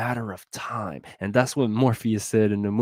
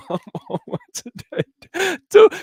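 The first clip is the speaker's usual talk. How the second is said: laughing; 8 dB louder than usual. Real, not acted. Laughing at his own excitement.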